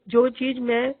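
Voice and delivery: normal talking voice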